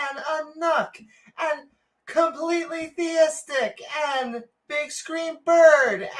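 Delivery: high, excited livestream greeting; excited shout-out. Retold or imitated. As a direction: gush over every viewer.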